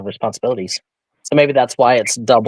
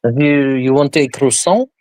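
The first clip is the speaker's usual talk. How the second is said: playful put-on French accent; mock French accent; 3 semitones higher than usual. Auction seller obliging a listener, joke French accent. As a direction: ham up a fake French accent. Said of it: "Let's hear it in a French accent".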